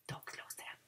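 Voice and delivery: unvoiced whispered aside; hushed teacher whisper. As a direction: whisper the warning secretly. Aside during the game.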